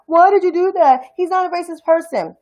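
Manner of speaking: mocking the people who defend Trump; mocking critics. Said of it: "And people are like".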